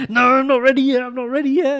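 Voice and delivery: very high, loud mock protest; mock flustered wife. Husband mocking his wife stalling the waiter, high squeal. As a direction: squeal it like a panicking diner. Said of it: "she's like".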